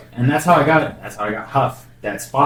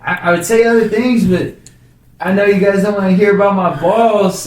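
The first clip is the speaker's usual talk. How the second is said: mild mock nervous voice; nervous self; 8 semitones higher than usual. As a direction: squeak it out like a frozen comic. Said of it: "I was like".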